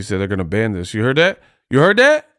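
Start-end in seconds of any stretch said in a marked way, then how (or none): 1.7-2.3 louder, much higher rising question; incredulous repeat. Real, not acted. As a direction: press the question home, pitch shooting up. Repeats question in disbelief at clip.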